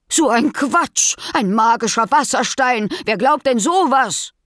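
indignant. Acted. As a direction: scoff at the nonsense, outraged. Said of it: "empörte sich die Wildschweinmutter".

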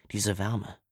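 ordinary delivery

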